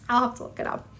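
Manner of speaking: ordinary talk, nothing marked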